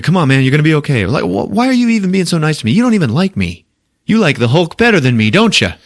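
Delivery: hammed-up needy complaint; whiny Banner. Banner whining at Thor.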